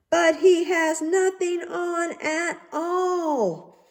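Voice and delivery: the whole crowd shouting together; crowd crying out. A narrator's reading. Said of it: "they cried out all together".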